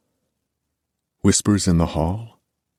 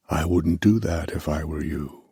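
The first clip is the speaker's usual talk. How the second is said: put-on old accented warning voice; 4 dB quieter than usual. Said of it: "There's an accent, there's age".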